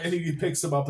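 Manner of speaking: ordinary talk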